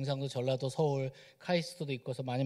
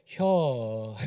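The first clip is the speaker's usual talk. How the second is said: Chungcheong dialect word; 4 semitones lower, 6 dB louder than usual. Retold or imitated. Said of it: "뭐만 하면 혀래요".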